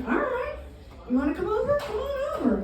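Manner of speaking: high, soft sing-out invitation; retold coy invitation. Woman retelling her invitation to a date, high and coy. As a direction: lilt it up high and quietly.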